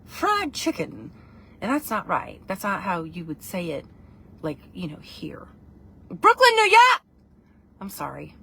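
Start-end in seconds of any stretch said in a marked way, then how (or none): 0.0-1.1 drawn-out, high-pitched proper English "fried chicken"; proper English accent. Retold or imitated. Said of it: "It comes out sounding like". 6.1-7.0 loud, high Brooklyn shout; Brooklyn accent. Shown as a demonstration. Sudden Brooklyn accent demo on stream.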